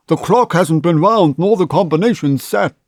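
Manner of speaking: put-on high, loud strained groan of an old man; groaning old banker. A narrator's reading. Banker groaning that the vault door won't open. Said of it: "he groaned".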